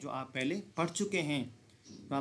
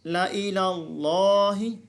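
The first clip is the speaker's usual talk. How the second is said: slow, louder chanted recitation with long vowels; Quranic recitation; 3 semitones higher, 5 dB louder, slower than usual. Shown as a demonstration. Teacher reciting the verse for students to follow. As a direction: chant each word slowly and fully. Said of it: "जी".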